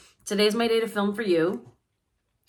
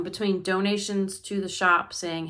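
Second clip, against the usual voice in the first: ordinary talk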